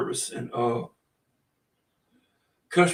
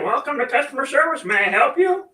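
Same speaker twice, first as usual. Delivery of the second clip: squeaky high cartoon chicken, polite service greeting; 11 semitones higher, 4 dB louder than usual. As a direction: play a chicken chirping a cheery service greeting, much higher. Chicken answers podcast as service desk.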